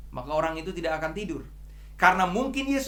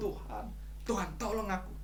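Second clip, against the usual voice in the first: much softer, sinking prayer; soft plea; 3 semitones higher, 8 dB quieter than usual. Retold or imitated. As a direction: let the cry fade to a hushed plea. (permohonan lirih dan pelan)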